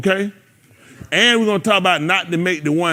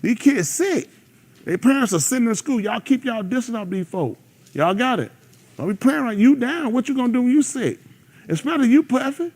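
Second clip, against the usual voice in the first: raised pitch, urgent caution to the youngsters; stern warning to the kids; 8 semitones higher than usual. Retold or imitated. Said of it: "Tell them".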